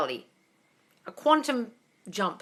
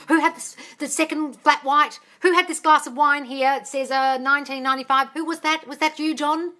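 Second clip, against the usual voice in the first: higher, mock-worried diner; fussing over bill; 6 semitones higher, 4 dB louder than usual. Diner dividing up a restaurant bill, fussing. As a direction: act out a penny-pincher querying each item.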